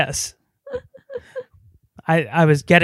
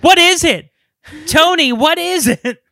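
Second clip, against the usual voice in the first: high, loud acted pestering; mock shrill demand; 11 semitones higher, 6 dB louder than usual. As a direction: pipe up loudly in a put-on high voice. Acted caller hounding Hopkins.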